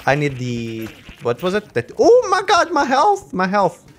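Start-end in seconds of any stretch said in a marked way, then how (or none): 2.0-3.8 high, loud alarm easing down; panicked exclamation. Real, not acted. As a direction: cry out as health plummets. Health suddenly dropping.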